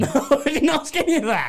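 laughing. Real, not acted. Laughing denial.

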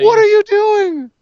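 very high, stretched-out yell; exasperated scream. Real, not acted. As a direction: screech it in disbelief. Exasperated outburst at wrestling show.

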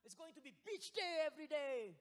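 hammed-up cheerful promise, rising high and loud; over-the-top sales pitch, building to a loud finish; mock sugarcoating. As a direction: gush it in fake excitement. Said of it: "I'm not going to sugarcoat it".

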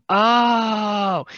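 long, high, loud "oh" of understanding; dawning realization. Acted. Viewer reacting to a plot twist that makes sense, dawning realization. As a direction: draw out an impressed "oh" as it clicks.

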